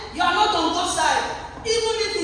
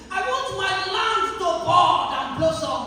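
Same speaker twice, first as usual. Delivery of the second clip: ordinary talk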